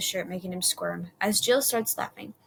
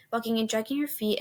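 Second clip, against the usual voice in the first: ordinary delivery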